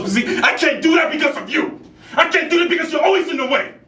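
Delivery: very high, anguished yelling; furious outburst. Acted. Actor blaming the other for their misery, furious. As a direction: break into desperate, enraged shouting.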